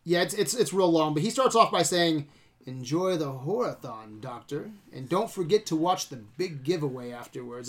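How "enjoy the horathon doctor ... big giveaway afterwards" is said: low, soft, measured villain monologue; Connell Cochran. Retold or imitated. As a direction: imitate an evil cult leader's quiet speech. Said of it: "I'm not doing his, his, uh, accent".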